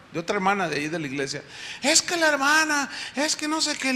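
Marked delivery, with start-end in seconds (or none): 1.8-3.9 complaining church lady. Retold or imitated. Complaining church woman quoted.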